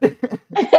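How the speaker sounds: high, bright burst of laughter. Real, not acted. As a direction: let out a high delighted laugh. Laugh after proud joke.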